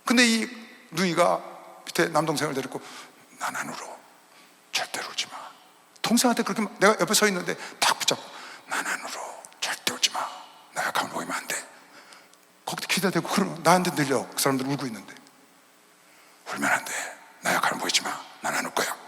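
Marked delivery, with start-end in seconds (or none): 3.4-5.4 hushed, voiceless resolve; soft whispered vow not to cry; retold whisper. Girl whispering to brother at funeral. 8.7-11.6 hushed, voiceless resolve; soft whispered vow not to cry; retold whisper. Girl whispering to brother at funeral. 16.5-18.9 hushed, voiceless resolve; soft whispered vow not to cry; retold whisper. Girl whispering to brother at funeral.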